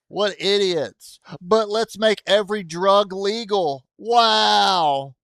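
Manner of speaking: loud, high mocking rant; sarcastic outrage. Real, not acted. Streamer mocking Oregon's laws, loud sarcastic disbelief. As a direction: scoff loudly, ending on a big drawn-out wow.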